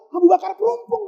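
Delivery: ordinary talk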